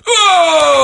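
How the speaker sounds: long, loud, high "oh" holler; hammed-up whooping cheer, sliding down; mock brothers' holler. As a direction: imitate rowdy brothers yelling in response. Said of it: "guys who just go".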